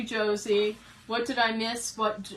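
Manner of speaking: ordinary talk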